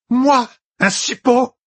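very high, drawn-out indignant exclamation; outraged cry. A narrator's reading. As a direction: cry out slowly in disbelief and outrage. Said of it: "cria Elaïda". (cri indigné, aigu et étiré)

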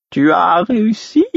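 high, slow, drawn-out question; stretched high-pitched query; fool's voice. A narrator's reading. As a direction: ask in a high put-on fool's voice. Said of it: "demanda le fou à mi-voix".

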